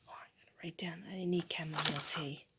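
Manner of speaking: usual speaking voice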